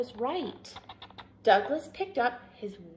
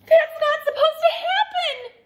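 very high, loud alarm; dismayed outcry; 8 dB louder than usual. A narrator's reading. Douglas seeing his hat unravel, high dismayed cry. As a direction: exclaim it high in shock. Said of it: "he said".

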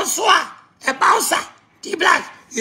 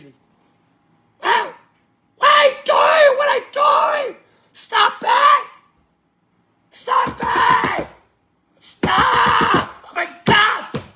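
angry yelling; 8 semitones higher, much slower than usual. Acted. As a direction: yell at them to stop it. Mock fight, yelling at someone.

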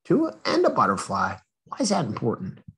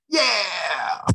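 excited cheer; 7 semitones higher than usual. Acted. Story ends cheerfully.

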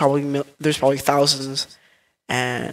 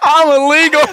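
yelled much higher and louder; shouting; 12 semitones higher, 7 dB louder than usual. Acted. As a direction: belt it out as a yell. Prank caller repeats it shouting.

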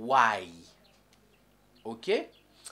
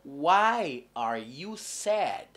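why are you sad drawn out very slowly, why stressed; teacher modelling why are you sad; very slow model question; 3 semitones lower, much slower than usual. Shown as a demonstration. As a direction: spell it out slowly for learners. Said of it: "La question c'est".

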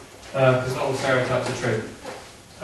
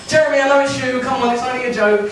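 high, strained plea to a prankster; beg, voice shooting up; panicky plea; 10 semitones higher, 3 dB louder than usual. Acted. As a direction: caught by a prank show, pleading high. Victim of prank pleading.

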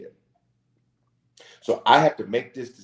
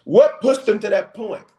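loud preaching; 12 semitones higher, 6 dB louder than usual. Real, not acted. Preacher at full force.

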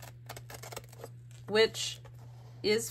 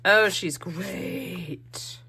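delighted; 4 dB louder, much slower than usual. Real, not acted. Delighted at her collage.

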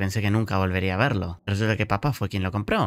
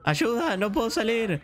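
put-on trapped child; 13 semitones higher, slower than usual. Trapped child crying for help.

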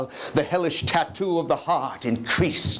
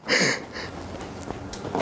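hushed giggle; 2 semitones lower, 7 dB quieter than usual. Acted. Madman gloats over hidden body.

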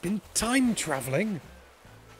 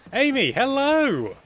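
high, loud and drawn-out hello; excited greeting; 11 semitones higher, 6 dB louder, slower than usual. Real, not acted. Streamer greeting the island host, excited hello. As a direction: call out to her with delight.

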